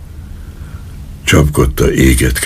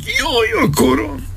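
pained cry; 6 dB quieter than usual. Acted. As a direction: cry out in pain, pitched far up. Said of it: "Ordította el magát". (fájdalmas, éles jajkiáltás)

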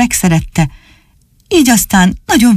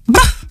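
high snorting puppy sneeze; dog sneeze; 13 semitones higher than usual. Acted. Csupi sneezes after licking Dani's salty face, a puppy sneeze. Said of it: "aztán nagyot prüszkölt".

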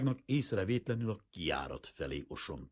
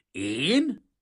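raised, loud questioning growl; indignant growl; 5 semitones higher, 6 dB louder than usual. A narrator's reading. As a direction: growl back, offended at the idea. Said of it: "mordult föl Kazimír". (sértődötten felmordulva)

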